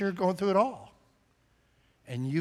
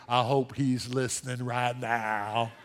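pointed remark; 6 semitones lower, 5 dB quieter, slower than usual. Acted. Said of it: "looking straight at me saying".

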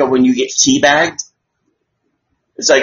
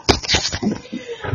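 high unvoiced laugh; 9 dB quieter than usual. Real, not acted. Reacts to own joke.

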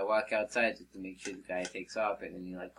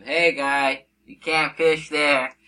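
loud, higher, drawn-out warning; calling out; 5 semitones higher, 11 dB louder, much slower than usual. Retold or imitated. Worker warning a fisherman from the rocks, calling out. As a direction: call it out firmly across rocks. Ran down, warns the fisherman again.

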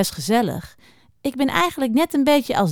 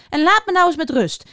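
raised, exasperated voice; fed up, wanting to be left alone; irritated; 7 semitones higher than usual. A narrator's reading. Hedgehog tells off the pestering hare, irritated.

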